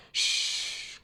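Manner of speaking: soft unvoiced shush; whispered hush. A narrator's reading. Cuphead hushing the puppet-happy Mac, whispered. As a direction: hush him quietly. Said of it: "Cuphead told him".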